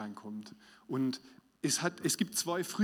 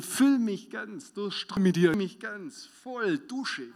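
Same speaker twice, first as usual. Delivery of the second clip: fervent prayer; 6 semitones higher, 3 dB louder, slower than usual. Shown as a demonstration. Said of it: "Und mit dir".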